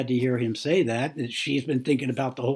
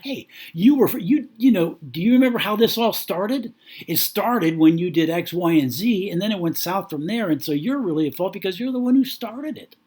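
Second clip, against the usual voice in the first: accusing; 6 semitones higher, 3 dB louder than usual. Acted. Acted wife blaming her husband.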